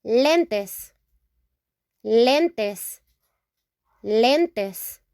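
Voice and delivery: lentes with pure e vowels, len-tes; teacher modelling lentes; Spanish pronunciation. Shown as a demonstration. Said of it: "Here we say".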